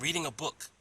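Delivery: said just like that. Retold or imitated. Man reading answers officer's question, pointed reply. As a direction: re-enact the pointed little answer. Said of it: "And I said it just like that".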